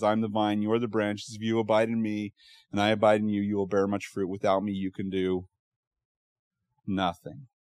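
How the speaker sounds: soft, low, reverent reading of the verse; hushed scripture reading. Acted. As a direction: lay the words down gently, like a prayer. Quotes John chapter fifteen.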